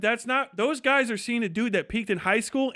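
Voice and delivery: normal talking voice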